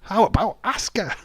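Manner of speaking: high-pitched mock flourish; gleeful one-upping. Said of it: "That's a company what's just saying".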